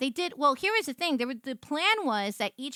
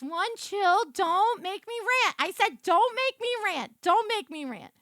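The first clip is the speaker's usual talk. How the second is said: mock warning; 7 semitones higher than usual. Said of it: "I was like".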